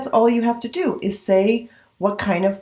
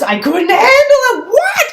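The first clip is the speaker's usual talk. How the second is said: laughing disbelief; 7 dB louder than usual. Retold or imitated.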